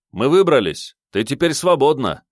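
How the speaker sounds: high, loud, elated call; joyful shout. Acted. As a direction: shout good news to a friend. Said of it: "крикнул я, ей улыбнувшись".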